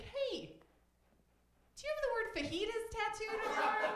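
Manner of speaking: high-pitched mock bar guy; nosy bar stranger. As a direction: pipe up high with an oblivious question. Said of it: "a guy came up to me".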